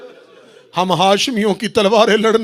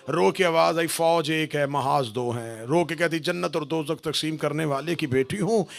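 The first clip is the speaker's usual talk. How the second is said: majlis recitation building; 8 dB louder than usual. Acted. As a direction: build the grief name by name until it cries out. Majlis recitation rising in grief. (मजलिस का मर्सिया अंदाज़, धीरे से शुरू होकर ऊँचा होता हुआ)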